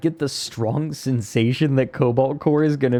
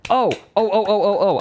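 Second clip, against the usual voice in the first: high, rapid excited ohs; bubbling over with a sudden memory; giddy seal-like squeals of excitement; 9 semitones higher than usual. Real, not acted. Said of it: "I'm clapping like a seal".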